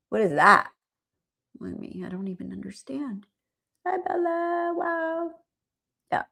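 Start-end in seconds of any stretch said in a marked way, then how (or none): none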